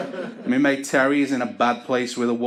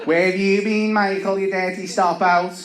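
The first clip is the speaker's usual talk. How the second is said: put-on higher scolding old nan; nagging nan; 7 semitones higher than usual. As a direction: tut at him like a shocked gran. Nan scolding grandson at dawn.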